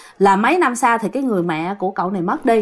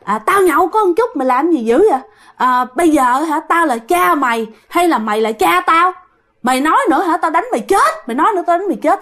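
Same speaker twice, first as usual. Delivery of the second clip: put-on drunken yelling; 7 semitones higher than usual. Drunk father yells threats at his son. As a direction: yell it like a drunk father ready to hit. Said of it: "ông la làng lên".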